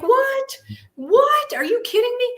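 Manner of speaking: very high-pitched, disbelieving "what?"; incredulous. Retold or imitated. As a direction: squeal it in stunned disbelief. Retelling disbelief as car starts.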